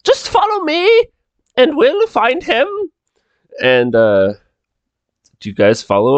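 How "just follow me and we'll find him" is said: very high put-on servant voice; Micah. Servant leads guests to the master, very high put-on voice. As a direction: lead the guests on in a high character voice. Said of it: "Micah's like".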